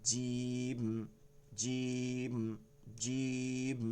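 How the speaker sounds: "জিম" drawn out level with a hard j and held m; firm jim. Shown as a demonstration. Teacher drilling against the buzzing letter, firm. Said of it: "শক্ত এবং কঠিন করে".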